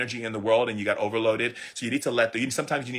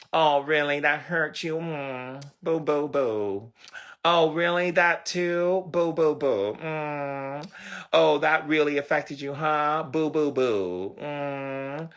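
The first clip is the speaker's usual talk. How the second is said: drawn-out boo-hoo pity, pitched up a little; mock sympathy; 3 semitones higher, slower than usual. Coach mimicking a pity party companion, mock sympathy. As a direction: coo with exaggerated fake concern.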